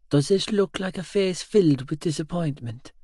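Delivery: put-on elderly voice, high and tender; reassuring great-grandmother. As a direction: gently challenge his guilt. Nanny reassures her great-grandson.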